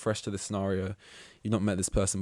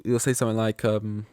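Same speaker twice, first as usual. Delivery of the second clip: usual speaking voice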